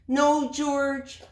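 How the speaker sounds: high, loud no; exasperated mom voice; scolding mother. Acted.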